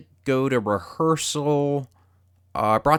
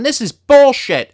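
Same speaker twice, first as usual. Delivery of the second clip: much higher, louder outburst; fed up at the parking attendant, shouting higher and louder; angry shout; 12 semitones higher, 9 dB louder than usual. Retold or imitated. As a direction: slam it down and yell.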